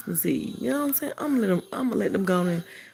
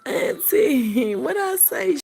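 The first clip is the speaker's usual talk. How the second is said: high, louder mock-wounded whine; mock hurt whine; 9 semitones higher, 5 dB louder, much slower than usual. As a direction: play wounded, hammed-up crying. Mock hurt reply to Chef.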